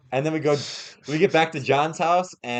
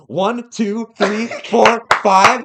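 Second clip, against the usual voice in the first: higher, louder count building to five; 6 semitones higher, 7 dB louder, much slower than usual. Retold or imitated. Waiter counting ketchup packets out to customers, loud counting. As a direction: count out each number big and loud.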